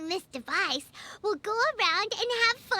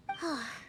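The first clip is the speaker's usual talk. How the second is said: a soft, much quieter sigh of frustration; 8 semitones lower, 9 dB quieter than usual. Acted. Paimon sighs quietly, unsure how to fool Yoimiya. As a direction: let out a small stuck sigh.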